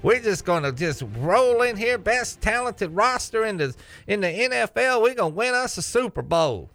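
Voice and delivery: high mock Texas accent of a boastful Cowboys fan. Host mocking Cowboys fans in a put-on Texas accent. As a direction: brag like a Texan fan, drawling it out. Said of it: "Is [name]'s Texas accent good?".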